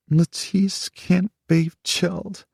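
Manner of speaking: muffled, tearful, slow and high; muffled against chest. A narrator's reading. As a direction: speak crying into his chest. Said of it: "said against his chest".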